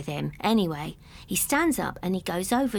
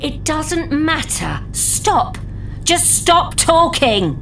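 angry; 6 semitones higher, 6 dB louder, slower than usual. Acted. Double snaps at chatterer.

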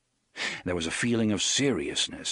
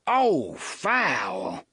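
cry of disgust; 3 semitones higher than usual. Acted. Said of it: "he cried".

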